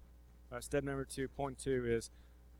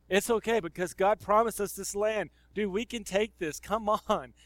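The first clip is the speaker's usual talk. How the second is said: higher, louder, a pumped-up rally to the team; eager encouragement; 6 semitones higher, 3 dB louder than usual. Retold or imitated. Joshua rallies the doubting spies, lightly voiced and pumped up. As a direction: cheer the scared spies on, half-acted. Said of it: "But Joshua's like".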